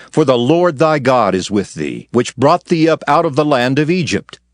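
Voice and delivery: usual speaking voice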